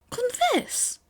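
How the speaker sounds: high, ringing cry of Confess!; grand proclamation. Acted. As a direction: announce it like a revelation. Aoyama proclaims advice.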